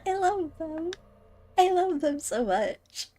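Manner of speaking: high, adoring gush. Real, not acted. Streamer gushing about a beloved villain, high and adoring. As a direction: swoon over the character. Gushing over the sponsor character.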